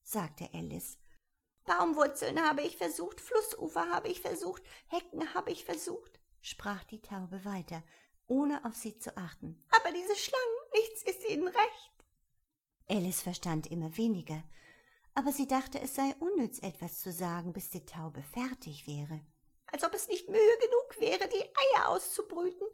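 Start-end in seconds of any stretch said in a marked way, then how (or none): 1.6-12.0 high, put-upon bird voice, not listening to anyone; complaining pigeon in a higher voice; fussy pigeon. A narrator's reading. 19.7-22.7 high, put-upon bird voice, not listening to anyone; complaining pigeon in a higher voice; fussy pigeon. A narrator's reading.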